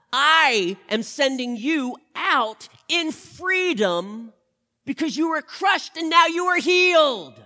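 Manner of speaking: loud, high preaching shout; proclaiming. Acted. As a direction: proclaim it to the whole room. Preacher proclaiming Jesus' words.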